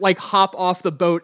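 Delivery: usual speaking voice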